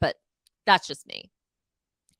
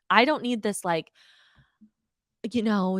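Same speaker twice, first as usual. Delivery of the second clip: ordinary talk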